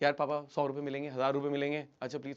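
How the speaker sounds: meek, pleading request; pleading deference. Acted. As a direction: ask sheepishly for money. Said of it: "dad से पैसे मांगने".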